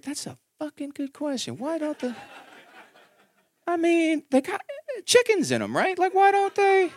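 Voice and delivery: high, hushed, amazed; baffled inner voice. Retold or imitated. Dad thinking to himself about why eggs don't hatch, baffled. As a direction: wonder to yourself in disbelief.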